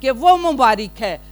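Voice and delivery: high, loud proclamation in repeated bursts; crying out. Acted. Preacher voicing the stones crying out in praise, loud proclamation. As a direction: cry each line out like an acclamation. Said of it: "ए भी चला उठणगे".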